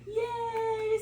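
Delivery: put-on squealed high cheer for the baby; excited praise. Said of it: "lots of praise".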